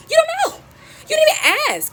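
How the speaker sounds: squeaky acted indignation; exasperated retort; high-pitched outburst. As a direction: jump up high in protest.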